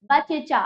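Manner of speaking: बच्चा misread as ba-che-chaa; wrong full च. Shown as a demonstration. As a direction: put a vowel between the two च of बच्चा. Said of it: "पूरा चा कर देते तो क्या होता?".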